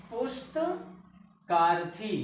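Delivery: पुस्तकार्थी said slowly and evenly; slow spelled out. Shown as a demonstration. Teacher showing students the joined word, slow and careful. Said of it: "teacher sounds out the joined word".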